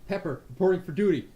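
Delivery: bright eager soldier reporting. Acted. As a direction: Pepper reporting keenly to the leader. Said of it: "He's like".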